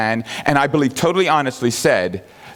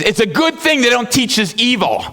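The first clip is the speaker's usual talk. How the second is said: mock exasperation; 10 semitones higher than usual. Frustrated atheist quoted.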